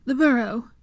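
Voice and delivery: short high call-out. A narrator's reading. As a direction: announce the destination clearly. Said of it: "before calling".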